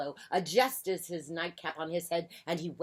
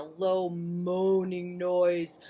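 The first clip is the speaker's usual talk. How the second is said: slow, loud, drawn-out narration; moaning; 6 dB louder, much slower than usual. A narrator's reading. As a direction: stretch the words into a spooky moan. Narrator imitates the spooky moaning.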